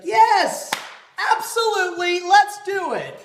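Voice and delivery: loud, high mock-enthusiastic cheer; hammed-up gung-ho yes; over-the-top eagerness. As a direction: belt it out like the ideal playmate, stretching the end. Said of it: "supposed to just be like".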